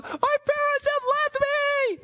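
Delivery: put-on high, wailing cry of a lost child; a child panicking that his parents are gone, wail it high; panicked child wail.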